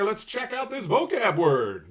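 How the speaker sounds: slow, drawn-out announcer build-up. Acted. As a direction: present it like a game show host. Announcing vocab segment.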